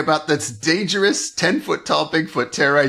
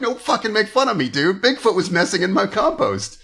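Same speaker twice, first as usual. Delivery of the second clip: higher-pitched offended protest; playfully aggrieved retort; mock indignation; 6 semitones higher than usual. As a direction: push back as the insulted farmer. Acts out offended farmer's retort.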